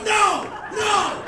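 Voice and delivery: two loud, high screams; furious refusal. Acted. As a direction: bellow back, refusing to calm down. Livid man refusing to calm down.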